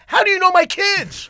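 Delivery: a shocked father calls out from the crowd; stunned yell; astonished shout. Retold or imitated.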